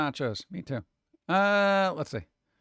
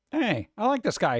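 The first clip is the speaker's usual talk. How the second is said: usual speaking voice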